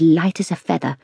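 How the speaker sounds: usual speaking voice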